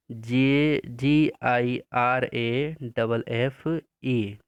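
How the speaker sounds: slow, spaced-out letters for copying; slow dictation spelling. Shown as a demonstration. Teacher dictating spelling slowly to pupils. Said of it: "फिर से वही लिखेंगे".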